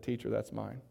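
very quiet, low, downcast voice; saddened confession. Retold or imitated. Ashamed little boy confesses to his teacher, saddened. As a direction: own up softly, head hung low. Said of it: "he began to weep".

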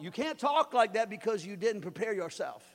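usual speaking voice

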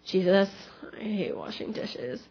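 retold moan about a chore, very soft; complaining. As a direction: grumble it under your breath.

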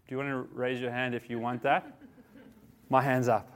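normal talking voice

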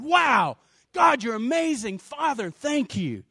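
high, exuberant praise; acted elation. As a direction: burst out in thrilled gratitude. Imagined Jesus thrilled with Father.

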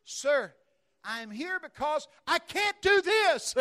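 loud and slow, high, spelling it out; insistent, as if to the deaf. Retold or imitated. Woman repeating herself to the preacher, loud and slow. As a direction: say it again as if he can't hear. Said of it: "like I'm hard of hearing".

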